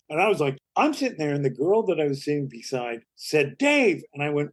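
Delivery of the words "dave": urgent call. Retold or imitated. Date calling his name.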